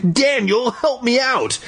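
very high pleading call; high urgent cry for help. Acted. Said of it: "I cried".

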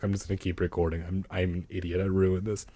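soft, fast self-scolding mutter. Real, not acted. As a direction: grumble it to yourself quickly. Muttering after his mistake.

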